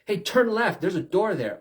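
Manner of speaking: loud, high-pitched aggressive command. Acted. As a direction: shout the direction forcefully. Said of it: "if he says it aggressively in a way".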